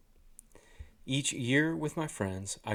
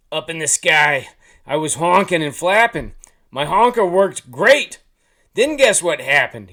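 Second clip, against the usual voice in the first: very loud goose storyteller; talking goose; 5 semitones higher, 13 dB louder than usual. A narrator's reading. Goose telling the curious hunter his misfortune, loud character voice. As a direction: belt out the goose's tale, building it up.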